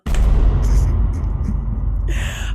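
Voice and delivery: big breathy burst of laughter; loud laugh. Real, not acted. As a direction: crack up at your own silliness. Laughs at own silly chant.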